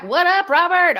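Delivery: excited. Real, not acted. Greeting a viewer live.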